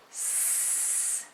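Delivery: "S" held as a quiet, long sss; letter sound. Shown as a demonstration. Teacher voicing the child's turn, "S" as a long soft sss. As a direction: sustain the sound of "S" without any voice. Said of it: "Your turn to say the sound".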